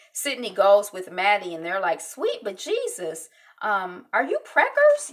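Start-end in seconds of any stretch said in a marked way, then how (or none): 2.0-5.1 teasing question. Retold or imitated. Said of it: "that's what Sydney is saying".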